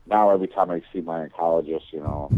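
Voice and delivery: ordinary talk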